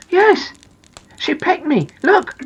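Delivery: high-pitched, plaintive storybook voice; aggrieved little character. Acted. Noddy shows Big Ears the peck, high and aggrieved.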